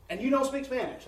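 high, loud disbelieving question; incredulous again. Retold or imitated. The local brother asks again in loud, high disbelief. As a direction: hit it like you can't believe it.

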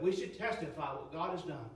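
ordinary talk, nothing marked

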